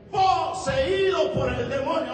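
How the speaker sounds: loud, high, slow preaching cry; forceful declaration. Acted. Preacher declaring the boy possessed, forceful cry. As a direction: thunder the verdict, drawn out.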